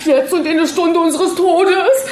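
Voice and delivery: high, loud desperate praying; frantic prayer. A narrator's reading. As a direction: pray for your life. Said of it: "betete in einem fort". (hohes, verzweifeltes Gebet)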